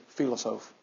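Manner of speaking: "philosophe" said softly the French way, fee-lo-ZOF, stress at the end; French pronunciation. Shown as a demonstration. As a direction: quietly repeat "philosophe" in French. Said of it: "That's just a cool French word".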